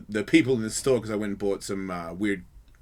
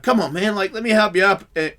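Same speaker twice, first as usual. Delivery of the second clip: raised-pitch, louder coaxing; coaxing plea; 7 semitones higher, 5 dB louder than usual. Retold or imitated. Helper coaxes drunk to his feet, coaxing plea. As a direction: coax someone stubborn, voice pitched up.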